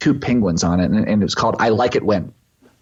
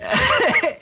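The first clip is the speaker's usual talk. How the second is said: high laugh. Real, not acted. Laughing after son's quote.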